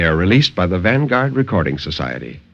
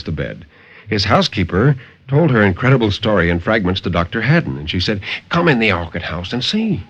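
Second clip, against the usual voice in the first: dramatic story narration. A narrator's reading. Narrator reading a horror tale to radio listeners, dramatic and intense. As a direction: tell the horror tale with rising urgency, then settle.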